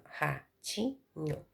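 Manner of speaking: "ratinho" slowed right down and broken into ra-ti-nho; teacher chopping "ratinho" into ra-ti-nho; split into syllables. Shown as a demonstration. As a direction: sound out "ratinho" in three slow, separate beats. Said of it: "então vai ficar assim".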